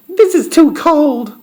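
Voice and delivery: put-on very high little-girl voice; Goldilocks complaining. A narrator's reading. Goldilocks tastes Mama Bear's porridge, high complaint.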